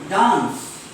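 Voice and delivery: DANCE with long open "ah", "daans"; broad British vowel in DANCE. Shown as a demonstration. Teacher introducing next word, DANCE as British "daans". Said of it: "In British English".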